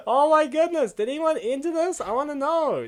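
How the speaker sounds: ordinary talk, nothing marked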